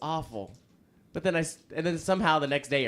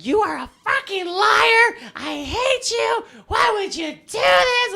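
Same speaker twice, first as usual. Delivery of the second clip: shrill furious screaming, much higher and louder; retold furious yelling; 9 dB louder, slower than usual. Drunk girlfriend screaming at him from the backseat. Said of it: "she was in the backseat still yelling at me".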